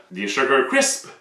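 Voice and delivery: usual speaking voice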